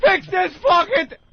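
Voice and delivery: high, loud, drawn-out furious yell; losing their mind. Acted. Deli worker losing it when the slicer breaks, acted furious shout. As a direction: blow up in exasperated rage, hammed-up.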